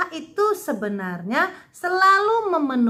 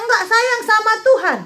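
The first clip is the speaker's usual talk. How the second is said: put-on sulky child; 8 semitones higher, 5 dB louder than usual. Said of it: "Nanti bilangnya".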